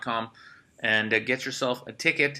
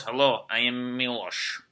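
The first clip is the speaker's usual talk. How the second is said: put-on slow, heavy Eastern European accent on Milos; mock Serbian accent; slower than usual. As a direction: introduce yourself like a stern Serbian guy, slowly. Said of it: "I just like walking up to girls".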